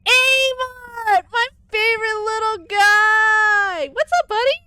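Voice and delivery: squealing pet voice, high and loud; high baby-talk greeting. Acted. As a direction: gush over a little buddy.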